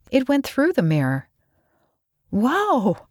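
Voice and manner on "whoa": high, drawn-out cry of shock; startled shout. A narrator's reading. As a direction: let out a pitched-up yelp. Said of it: "Callie shouted".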